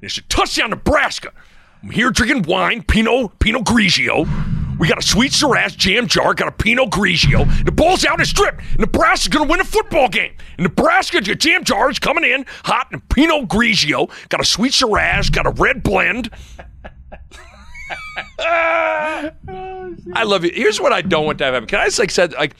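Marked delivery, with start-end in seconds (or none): 0.0-16.3 high, excited sportscaster call; Greg Sharp impression. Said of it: "Give us a Greg Sharp". 17.7-18.3 high squealing laugh. Real, not acted. Laugh after impression. 18.4-20.5 drawn-out shout. Acted. Shouted after impression.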